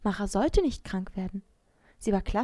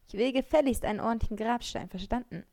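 usual speaking voice

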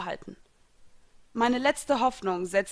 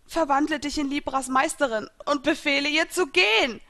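higher and louder, a last desperate order; urgent shouted command; 6 semitones higher, 5 dB louder than usual. A narrator's reading. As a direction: cry it out with your last strength. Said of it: "schrie ich hinaus".

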